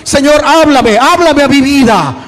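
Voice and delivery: loud rallying shout, building; full-force preaching. Real, not acted. Pastor urges the church to ask God to speak, shouted preaching. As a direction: lead the crowd in a fervent call. Leads congregation in shouted response.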